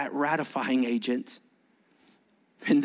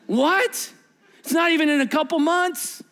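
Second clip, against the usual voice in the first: high, incredulous outburst; flabbergasted protest; acted disbelief; 10 semitones higher, 4 dB louder than usual. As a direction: play a stunned disciple, voice jumping up. Disciple reacts in disbelief to prediction.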